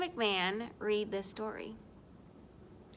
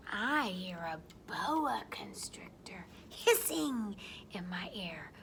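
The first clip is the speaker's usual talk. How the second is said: soft, suspenseful near-whisper; hushed snake line; 3 semitones lower, 7 dB quieter than usual. Pretend. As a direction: go quiet and secretive for the snake. Reading the snake's line softly.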